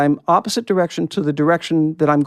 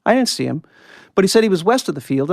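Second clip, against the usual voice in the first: usual speaking voice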